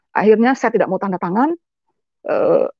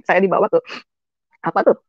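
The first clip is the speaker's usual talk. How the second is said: ordinary delivery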